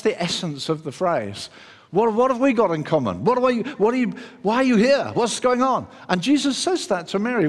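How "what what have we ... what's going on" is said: higher, insistent string of questions; challenging questions. Acted. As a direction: fire off bewildered questions, pitched up. Acting out the demoniac's challenge.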